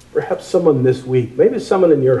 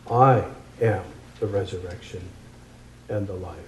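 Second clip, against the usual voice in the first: hushed, solemn; 5 semitones lower, 10 dB quieter than usual. Acted. Jesus's powerful words.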